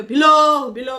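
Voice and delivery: Below, below blared out high and loud, drawn down then up; mocking loud call. Mocking the husband calling his wife below below on camera, loud high call. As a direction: belt below below out in ridicule. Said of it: "करके बोल रहा है".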